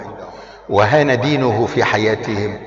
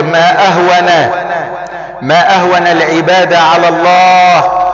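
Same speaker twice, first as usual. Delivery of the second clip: preaching at full force; 6 semitones higher, 5 dB louder than usual. Acted. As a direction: thunder the warning at the congregation. Preaching climax, shouted.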